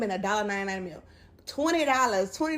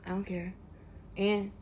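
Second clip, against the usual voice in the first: imitating her offhand, couldn't-care-less shrug; nonchalant; 7 dB quieter than usual. Commentator mimicking the fired worker who sat there, nonchalant. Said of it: "you sat there so nonchalant".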